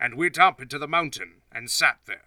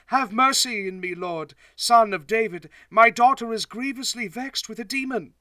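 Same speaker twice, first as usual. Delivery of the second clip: higher, pleading mother's voice; pleading woman; 9 semitones higher than usual. Acted. Canaanite woman cries out high and pleading to Jesus. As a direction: beg for help for her daughter. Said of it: "and cried to him".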